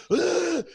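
high mock hacking cough; imitated cough. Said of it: "coughing a lung up".

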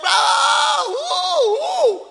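high, loud hammed-up wail of weeping people; high mock cry, shouted. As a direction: wail it out like a crowd crying, overdone. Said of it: "they were crying".

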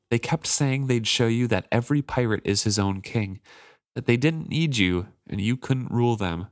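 usual speaking voice

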